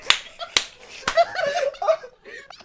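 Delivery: high, loud shrieking laughter. Real, not acted. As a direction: scream with laughter. Laughing after the bit.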